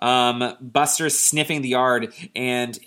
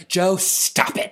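high, slow mock scolding; exasperated parent; 6 semitones higher, much slower than usual. Parents woken by Joe, drawn-out exasperated scolding. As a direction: drag it out, fed up with him.

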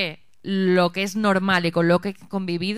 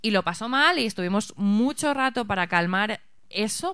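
normal talking voice

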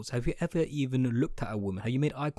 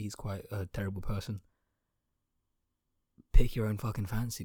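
ordinary talk, nothing marked

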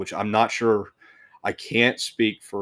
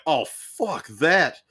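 slow, higher and louder recoil; a loud, slow, higher retold recoil; retold disgust at the snake; 7 semitones higher, 6 dB louder, much slower than usual. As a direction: drag the words out, backing away from the python. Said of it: "I was like".

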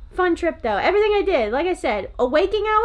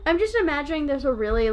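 ordinary talk, nothing marked

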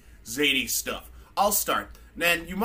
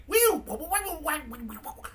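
high droid warbling noises; R2-D2 beeps; 12 semitones higher than usual. Retold or imitated. Host imitating R2-D2 beeping at C-3PO. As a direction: mimic a little robot beeping back. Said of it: "And R two D two's like".

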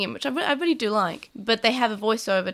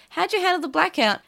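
higher classmate voice; 8 semitones higher than usual. Retold or imitated. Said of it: "someone says".

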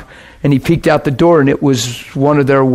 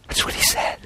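breathy whisper, all the sound dropped away; a soft whispered aside after the solemn warning; 11 dB quieter than usual. Real, not acted. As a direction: let it fall to a hushed whisper for weight. Hushed after quoting a death warning.

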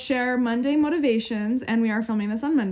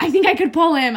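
loud mimicking echo; 6 semitones higher, 8 dB louder than usual. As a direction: repeat it higher and louder, cutting in. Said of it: "Becca's like".

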